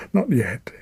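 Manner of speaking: panicked thought. Acted. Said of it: "he thought in panic".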